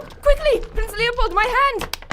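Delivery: loud, high, desperate shouting; urgent shout. Acted. Manjula shouting to Prince Leopold as he falls, desperate shout. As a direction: yell for his hand as he slips.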